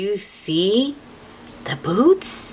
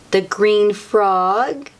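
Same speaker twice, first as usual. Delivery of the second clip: usual speaking voice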